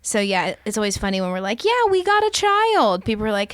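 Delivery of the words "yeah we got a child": high-pitched mock proud announcement; playful announcement. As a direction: announce it brightly, pitched well up. Retelling their playful announcement.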